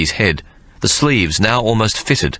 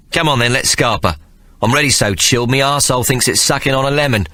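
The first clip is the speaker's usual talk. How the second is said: higher, hurried cockney urging; impatient cockney rogue; 6 semitones higher than usual. Acted. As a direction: hurry him along, impatient. Impatient Marley.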